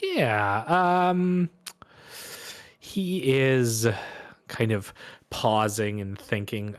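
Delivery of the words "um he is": ordinary delivery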